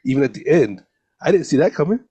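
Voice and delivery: normal talking voice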